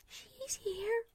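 high, terrified croak. A narrator's reading. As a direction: croak it out in fear. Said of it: "she croaked".

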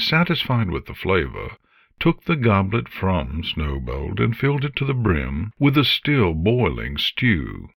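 usual speaking voice

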